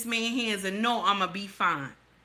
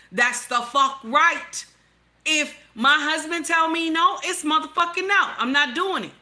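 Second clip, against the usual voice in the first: louder and higher, insistent and defiant; heated loud rant; 5 semitones higher, 4 dB louder than usual. Real, not acted. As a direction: hammer it home, higher and louder. Heated rant about obeying husband.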